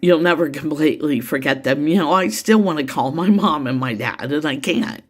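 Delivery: ordinary talk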